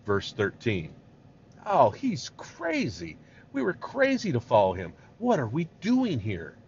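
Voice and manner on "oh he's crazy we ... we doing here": exasperated complaint. Acted. The people grumbling about following Moses, exasperated.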